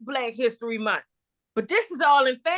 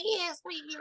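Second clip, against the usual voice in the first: mocking her voice; 3 semitones higher, 12 dB quieter than usual. Teasing a friend who says turn the music up, high mimicking voice. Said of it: "You sound so like".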